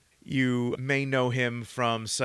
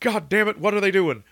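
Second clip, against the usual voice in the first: raised, louder exasperated yell; higher, loud mock outburst; yelling base commander; 8 semitones higher, 6 dB louder than usual. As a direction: bark it like an angry general at base. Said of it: "back at base yelling".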